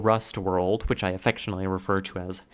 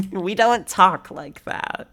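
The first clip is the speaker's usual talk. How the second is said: higher, slower mock lady voice; retold lady's retort; 8 semitones higher, 3 dB louder, slower than usual. Lady correcting the man on the streets of New York, drawn-out mock retort. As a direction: draw it out high as the objecting lady. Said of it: "There's this lady".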